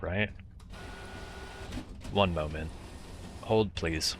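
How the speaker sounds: ordinary talk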